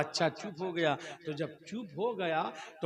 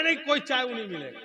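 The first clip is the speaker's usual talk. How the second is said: snappy refusal; 6 semitones higher, 4 dB louder, faster than usual. Acted. Wife refusing tea to husband.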